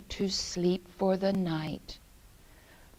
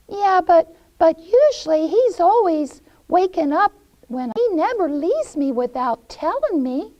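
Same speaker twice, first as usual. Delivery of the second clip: put-on high, quick little-girl voice; protesting Gretel; 8 semitones higher, 7 dB louder, faster than usual. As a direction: object in a higher, louder voice.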